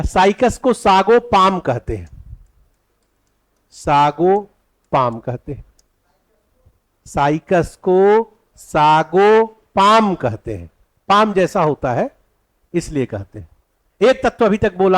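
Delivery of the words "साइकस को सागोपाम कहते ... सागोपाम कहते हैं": slow dictation, words held long. Shown as a demonstration. Teacher dictating the next point to students, slow dictation. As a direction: dictate in slow, separated chunks. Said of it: "अगला point लिखिएगा".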